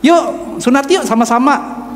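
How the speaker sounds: high-pitched, chummy invitation; imitated eager classmate. Classmate invites boy to be circumcised together, imitated eager coaxing.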